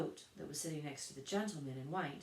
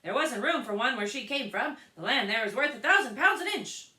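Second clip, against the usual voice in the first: loud, high mocking crowd; chorus of voices; 8 semitones higher, 15 dB louder than usual. A narrator's reading. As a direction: answer for her like a jeering chorus. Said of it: "the chorus of voices went on".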